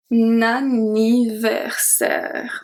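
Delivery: Anniversaire spoken very slowly, each syllable clear; slow model word. Shown as a demonstration. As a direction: break Anniversaire down slowly for learners. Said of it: "This one is a very long word".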